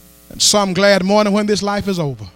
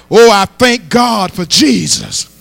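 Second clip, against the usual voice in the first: ordinary talk, nothing marked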